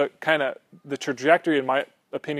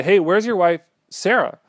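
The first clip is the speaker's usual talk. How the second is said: visitors calling out; 5 semitones higher, 5 dB louder than usual. Acted.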